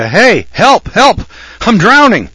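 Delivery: high, loud cry of a drowning man; mock yell for help. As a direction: shout for help from the water. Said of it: "yelling at you".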